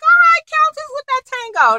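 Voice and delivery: gleeful shout-out from the couch; cheering. Retold or imitated. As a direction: hype her up like she nailed the move. Cheering the Countess's dance.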